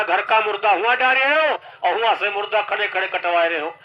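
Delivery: ordinary talk, nothing marked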